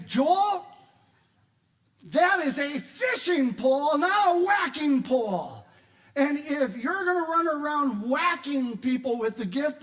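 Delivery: loud, high, emphatic parental telling-off; scolding dad. Acted. Dad scolding Joel over the fishing pole, stern. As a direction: lay down the law to a child.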